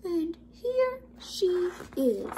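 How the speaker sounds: high, slow little voice; Baby Bear's cry. A narrator's reading. Baby Bear discovers Goldilocks, high drawn-out cry. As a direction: draw each word out high, like a cub. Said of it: "cried the Baby Bear".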